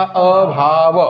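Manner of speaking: अभाव stretched very slowly, sound by sound; drawn-out syllables. Shown as a demonstration. Teacher spelling out the meaning of अ to the class, drawn-out अभाव. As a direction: draw out अभाव, holding the opening अ apart from भाव. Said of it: "अ चा अर्थ काय होतो सांगा".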